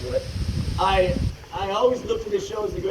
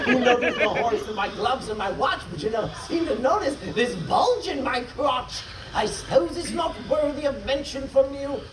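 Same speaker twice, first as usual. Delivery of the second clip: high, indignant verse complaint; aggrieved father's rant; 5 semitones higher than usual. Acted. Father complaining to his inattentive wife, aggrieved rant. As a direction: pitch the rhymed grievance high.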